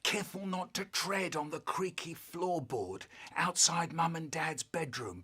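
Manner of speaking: normal talking voice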